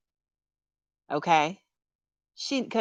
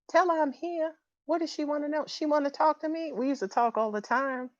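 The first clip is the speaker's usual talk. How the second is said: lightly acted late mother, higher pitched; higher, lightly put-on motherly voice; Felicia Rashad voice; 6 semitones higher than usual. Medium speaking as Janet about her daughter, lightly put-on higher voice. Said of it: "she's talking to me in a Felicia Rashad voice".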